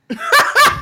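high, loud disbelieving laugh; incredulous laugh. Real, not acted. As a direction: laugh in exasperation. Incredulous laugh mid-rant.

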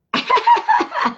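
high real laugh; high delighted laugh. Real, not acted. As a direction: burst out laughing at the text. Laughs at text message.

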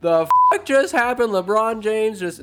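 can't believe what you just saw; disbelief. Acted. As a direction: Aragorn stunned by Gandalf's fall. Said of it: "Aragorn is in disbelief".

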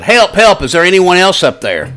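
high, loud desperate yell; frantic yell up the cliff. A narrator's reading. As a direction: shout for anyone else up there. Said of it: "Finally, Ben yelled".

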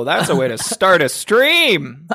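high, loud shocked whoa. Real, not acted. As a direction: react loudly, scandalised. Shocked reaction to crude joke.

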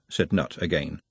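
plain reading voice

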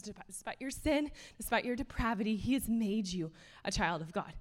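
ordinary talk

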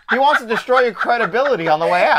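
high-pitched, talking through laughter; laughing hard. Real, not acted. Host piling onto the joke for co-host, laughing hard. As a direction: squeeze the words out while cracking up.

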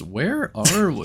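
put-on slow, confused old leader; senile Prime. Mocking the movie, slow confused senile voice. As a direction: sound lost and muddled. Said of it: "he sounded senile".

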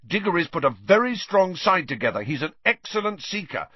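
agitated, high-pitched insistence; worked-up captain's warning; shouting. A narrator's reading. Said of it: "Wood shouted".

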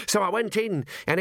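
high-pitched, eager junior constable; Constable Visit. Acted. Constable reporting a gruesome find to his commander, high and eager. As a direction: report it high and keen to a superior. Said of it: "said Constable Visit, saluting".

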